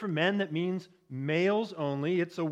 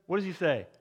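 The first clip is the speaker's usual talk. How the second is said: usual speaking voice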